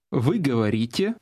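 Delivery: normal talking voice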